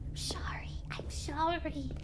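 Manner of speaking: breathless unvoiced apology; whispered sorry. Player whispers an apology to the narrator, whispered. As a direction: whisper it like a guilty secret.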